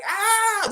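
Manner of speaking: high yelp of pain. Retold or imitated. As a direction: cry out as the arrow hits. Raptor shot in the leg.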